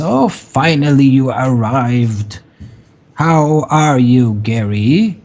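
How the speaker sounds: lightly voiced old crone; a hint of a sly old woman. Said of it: "very, very old woman".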